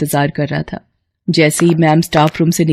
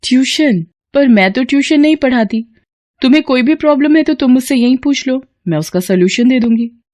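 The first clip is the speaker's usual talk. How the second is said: higher voice, taken aback then brisk; surprised teacher Kamya; 6 semitones higher than usual. Acted. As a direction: catch a student where he shouldn't be. Kamya refuses tuition. (ऊँची आवाज़ में हैरान teacher)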